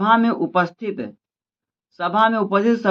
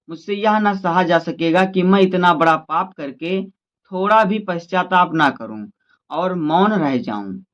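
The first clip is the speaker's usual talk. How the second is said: ordinary delivery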